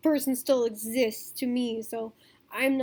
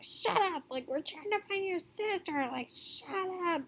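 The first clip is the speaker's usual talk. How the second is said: a hint of a snappy mom; mock hushed scolding, pitched high; mom snapping; 6 semitones higher, 5 dB quieter than usual. Mother hushing the brother in the van, mildly mimed scolding. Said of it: "the mom was just like".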